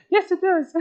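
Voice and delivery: high squeaky laughing yes; mock-blunt honest friend, laughing; laughing answer. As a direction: blurt it out through laughter.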